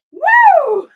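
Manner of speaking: high, shouted woo; loud whoop. Real, not acted. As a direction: let out a big high whoop. Whoops after the performance.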